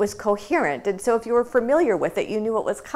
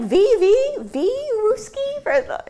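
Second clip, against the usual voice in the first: much higher, clumsy stumbling Russian with a drawn-out question; mock bad Russian; 4 dB louder, much slower than usual. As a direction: fumble a few words of broken Russian like a clueless tourist. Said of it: "it's like".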